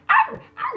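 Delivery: very high, loud "ah, ah" squeal; mock high squeal. Doing the comedy squeal she always does. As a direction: shriek it comically.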